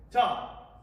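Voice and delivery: ordinary delivery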